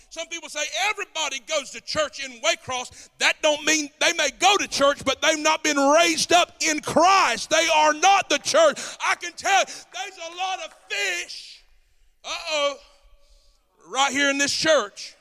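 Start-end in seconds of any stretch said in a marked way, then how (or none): 3.2-15.1 high, loud preaching, slowing; preaching shout. Real, not acted. Pastor shouting that the town isn't the church. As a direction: lift the voice and drive it home.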